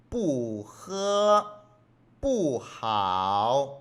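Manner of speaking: 不 held in its falling fourth tone, 喝 and 好 unchanged; slow, drawn-out 不喝, 不好 with every tone as written; Mandarin tone drill. Shown as a demonstration. Teacher modelling for students to repeat, slow unchanged tones on 不喝, 不好. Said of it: "Ahí no hay cambio de tono".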